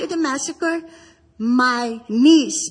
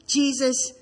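usual speaking voice

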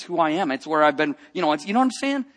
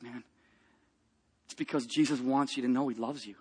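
tender; 5 semitones lower, 10 dB quieter than usual. Retold or imitated. Tenderly telling the soldier Jesus loves him.

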